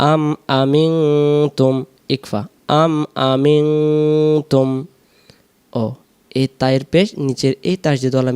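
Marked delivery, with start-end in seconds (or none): 0.1-4.7 আ আমিনতুম split slowly into syllables; broken apart. Shown as a demonstration. Teacher splits the word for students, slowly. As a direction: break আ আমিনতুম into slow pieces. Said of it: "ভেঙে ভেঙে".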